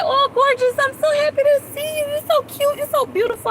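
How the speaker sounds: doting greeting. Retold or imitated. Mother greeting her daughter each morning, high cooing voice. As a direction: coo at a child you adore. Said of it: "and I'm like".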